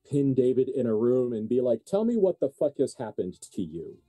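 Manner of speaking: ordinary talk, nothing marked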